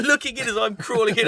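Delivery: ordinary delivery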